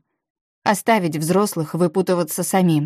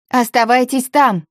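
raised, louder call from below; shouted call; 7 semitones higher, 5 dB louder than usual. A narrator's reading. Саки calls up to the others from below, shouting. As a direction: call out a command, higher and louder. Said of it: "крикнула Саки".

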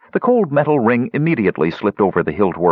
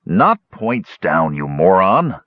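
usual speaking voice

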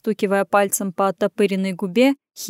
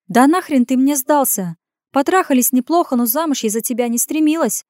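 higher, lively voice of a scornful woman; brash needling woman; 5 semitones higher, 3 dB louder than usual. Acted. Woman confronting a man over his feelings, brash and higher. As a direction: pitch it up, challenging him.

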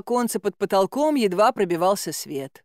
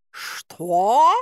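indignant cat; 4 dB louder than usual. Acted. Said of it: "возмутился кот".

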